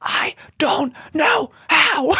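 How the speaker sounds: slow, exaggerated stage whisper; acted helpless whisper in reply; whispering back. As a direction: whisper back, spacing out each word. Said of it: "I'm whispering at you".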